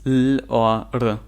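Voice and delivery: "L" as a held l sound, "R" as a bare r sound. Shown as a demonstration. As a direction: say "L or R" as sounds, lll or ruh, slowly. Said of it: "and the R or L as".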